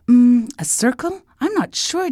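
put-on high, hesitant child; high-pitched doubtful child; unsure little princess. A narrator's reading. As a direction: guess uncertainly in a little girl's voice. Princess unsure of moon's shape.

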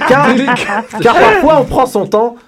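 hammed-up excitement; excited. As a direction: burst out with mock enthusiasm. Excited joking buildup.

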